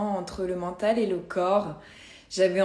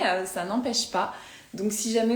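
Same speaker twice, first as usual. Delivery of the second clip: usual speaking voice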